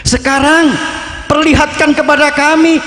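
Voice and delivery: high, fervent supplication; earnest prayer. Acted. The saint praying to calm the storm, fervent and high. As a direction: pray with awe to God.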